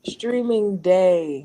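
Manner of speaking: "Streaming day" stretched out slowly; clear correction. Shown as a demonstration. Correcting someone who heard stringing, "Streaming day" said slowly. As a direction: draw out "Streaming" so it can't be misheard. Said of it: "What the frick is a stringing day?".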